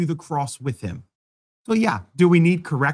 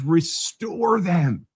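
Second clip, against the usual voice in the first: slow emphatic; 2 semitones higher, much slower than usual. Shown as a demonstration. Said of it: "No, no, it says".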